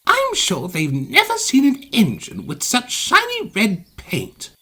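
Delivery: high, vain engine voice; boastful. A narrator's reading. Vain engine praises his own paint, boastful. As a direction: admire yourself out loud. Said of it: "James went on".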